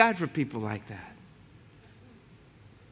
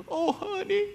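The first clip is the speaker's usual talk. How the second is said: put-on much higher, softer old lady's "honey"; tender old aunt; 14 semitones higher, 6 dB quieter, slower than usual. Aunt fondly greeting her nephew, high and soft. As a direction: coo it like a weepy elderly aunt. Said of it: "she starts crying".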